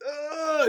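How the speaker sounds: a high pained ugh, as she falls on the stairs; imitated pained yell. Retelling the movie, her pained yell on the stairs. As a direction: mimic her hurt yell. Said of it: "she yelled real good".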